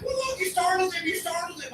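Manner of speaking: high, soft mock neighbor voice; complaining dog owner. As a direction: scold on behalf of the dog. Neighbor complains about startled dog.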